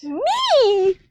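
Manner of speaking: high, loud, eager squeal; hammed-up high-pitched 'it's me'; mock-delighted claim. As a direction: burst out as the star. Reader jokingly claims the glorious role.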